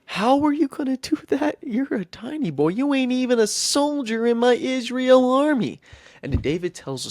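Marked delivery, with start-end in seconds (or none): 0.0-5.8 much higher, hammed-up disbelief; incredulous king. Saul questioning the tiny shepherd boy, high-pitched incredulity. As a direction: act out a king balking at a child.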